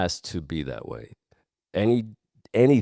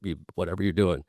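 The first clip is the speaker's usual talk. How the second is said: ordinary talk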